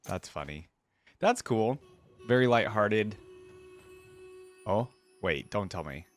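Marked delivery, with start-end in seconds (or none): none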